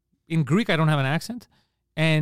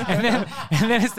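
words broken by real laughter, higher and louder; laughing; 6 semitones higher, 4 dB louder than usual. Real, not acted. Still laughing while riffing on comedians, real laughter. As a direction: keep laughing through the words.

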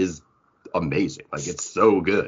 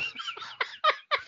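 high breathy giggle; 8 dB quieter than usual. Real, not acted. Teasing banter, laughing it off.

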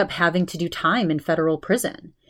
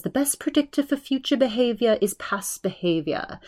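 higher-pitched imitation Australian voiceover; put-on Australian accent, raised pitch; 6 semitones higher, 3 dB quieter than usual. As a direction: mimic an Australian narrator, pitched up. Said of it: "with the Australian accent".

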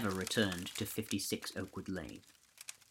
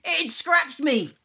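high, loud and drawn-out slow cry of pain; panicked scream; 10 dB louder, much slower than usual. A narrator's reading. Jordan scratched by the ghost, high panicked slow yell. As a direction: yelp it in terror. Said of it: "A scream came from Jordan".

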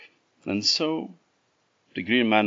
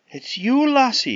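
high, louder amazed cry; surprised; 8 dB louder than usual. Acted. Jack realising the mule is his love, amazed. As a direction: gasp it out in disbelief.

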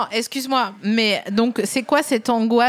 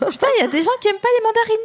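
excited disbelief; 11 semitones higher, much faster than usual. Real, not acted. Outraged disbelief, rushing.

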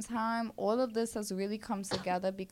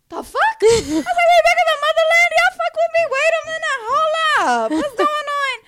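very high, loud, laughing disbelief; excited disbelief; 18 dB louder than usual. Retold or imitated. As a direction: squeal it out in happy shock. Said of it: "I'm like".

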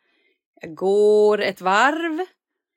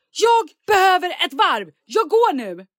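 loud exit; 9 semitones higher, 4 dB louder than usual. Retold or imitated. Storming off in frustration.

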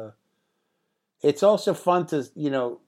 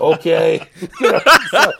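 laughing loud as you speak; laughing while telling the punchline; 3 semitones higher, 10 dB louder than usual. Real, not acted. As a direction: laugh right through the words. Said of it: "laughing after quote".

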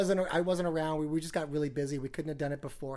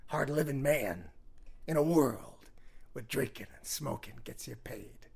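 movie trailer voice; 2 semitones lower than usual. Retold or imitated. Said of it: "the movie voice guy".

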